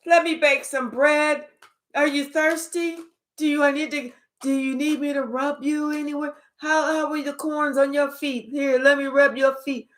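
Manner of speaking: mock the welcome you are expected to give; sarcastic, syrupy hostess voice; mock sweetness. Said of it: "I'm supposed to be like".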